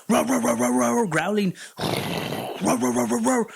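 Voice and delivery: imitated barking dog; imitating dog barks. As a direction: bark out a dog going crazy, playfully. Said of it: "he starts barking like fucking crazy".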